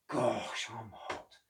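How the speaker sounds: overheated from mopping; exhausted. Acted. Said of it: "cried Oscar".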